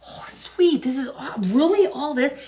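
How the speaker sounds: high, thrilled kid voice, imitating his delight; excited young kid. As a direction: play the kid who can't believe his luck. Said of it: "He's like".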